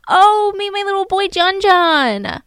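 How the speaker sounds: high, showing-off introduction; proud mock mom. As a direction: show off the baby to old friends. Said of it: "she would say".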